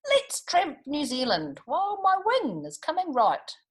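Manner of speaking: a mended bird keen to roam; cheery Goonie. A narrator's reading. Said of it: "He said".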